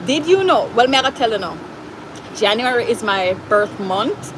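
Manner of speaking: usual speaking voice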